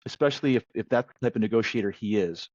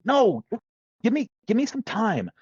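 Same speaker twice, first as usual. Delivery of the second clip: pleading for time; 9 semitones higher than usual. Retold or imitated. Buyer holds off the internal customer, pleading and higher. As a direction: beg for a little more time.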